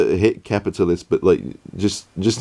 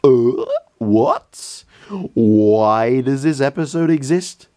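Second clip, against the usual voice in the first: high, slow baffled disbelief; 5 semitones higher, slower than usual. Real, not acted. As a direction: ask it as if truly lost. Baffled at the episode.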